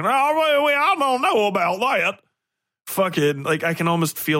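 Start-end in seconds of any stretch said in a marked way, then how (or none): 0.0-2.2 exaggerated hillbilly-style Appalachian accent. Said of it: "Once anybody from Appalachia or North Carolina starts talking".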